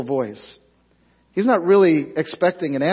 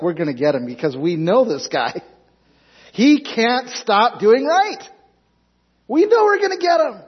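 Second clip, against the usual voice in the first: schemers' voice; 7 semitones higher, 3 dB louder than usual. Retold or imitated. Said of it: "They were like".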